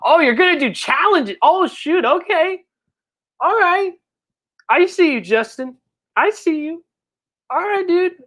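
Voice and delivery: excited. Real, not acted. Reacting to a viewer's challenge plans.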